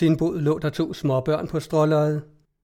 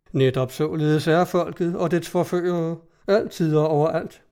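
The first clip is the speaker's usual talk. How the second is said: usual speaking voice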